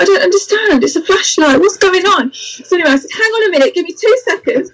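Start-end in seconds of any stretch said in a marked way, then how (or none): 0.0-2.3 higher-pitched, bewildered imitation; mock plaintive confusion; baffled client. 3.1-4.7 high-pitched, hasty-sounding hold-on; retold fluster, pitched well up; flustered stalling. Acts out flustered stalling of client.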